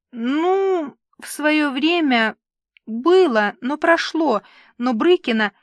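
stall, then excuse yourself piece by piece; awkward hedging. A narrator's reading. Papa admits an old affair to his daughter, hedging.

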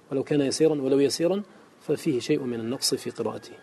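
ordinary talk, nothing marked

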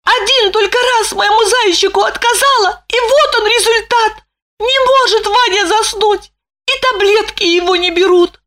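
high, anxious woman's voice; fretting wife. A narrator's reading. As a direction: worry it through quickly. Lipochka's worried thoughts.

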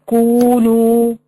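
كونوا with both oo vowels held very long; drawn-out long vowel. Shown as a demonstration. Showing the waw madd to students, vowels lengthened. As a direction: stretch each waw in كونوا on one note. Example word with prolonged madd.

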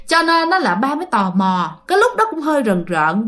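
ordinary talk

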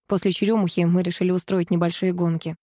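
ordinary delivery

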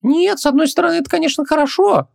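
very high-pitched little djinn; squeaky creature voice, backing down; Хубаксис, conceding. Acted. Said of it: "не стал спорить Хубаксис".

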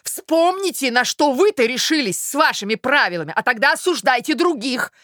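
high-pitched, heated retort; indignant outcry. A narrator's reading. Suitor turns her accusation back, indignant. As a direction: fling the blame straight back. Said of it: "вскричал он".

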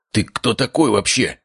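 croaked question. A narrator's reading. Stunned uncle questioning his nephew, croaking. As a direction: croak it out slowly in disbelief. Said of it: "прохрипел он".

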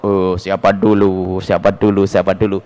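low, drawn-out self-important boast; mock boasting. Preacher imitates someone proud of blessings, mock boasting. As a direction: imitate someone smugly full of himself. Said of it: "jangan merasa berungkap".

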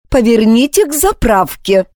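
calm but threatening; steely menace. A narrator's reading. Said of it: "стальные угрожающие нотки".

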